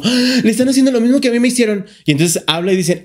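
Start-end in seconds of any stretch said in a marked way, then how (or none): 0.0-1.8 high, fast excited gasp; a mild mock outburst; excited realization. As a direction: blurt it out in a rush of recognition. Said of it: "se proyectó y dijo".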